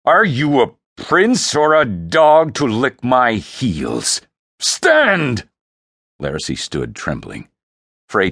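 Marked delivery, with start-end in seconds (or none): 0.1-5.5 loud, high-rising scornful command; furious emperor, building to a shout; thundering anger. Acted. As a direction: berate him, then roar the order. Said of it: "the Emperor thundered".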